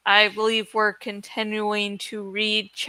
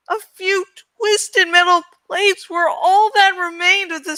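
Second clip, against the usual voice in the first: raised, tense dramatic reading voice; story narration; 6 semitones higher than usual. A narrator's reading. Narrator reading a fantasy novel to listeners, dramatic. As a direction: read the action with rising urgency.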